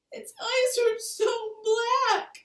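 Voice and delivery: put-on high, loud, very slow child's sob; sobbing. Little Laura sobs to Ma about the baby, crying. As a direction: wail it through tears. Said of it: "Laura sobbed".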